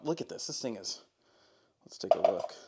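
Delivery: whisper. Real, not acted. Whisper heard on line.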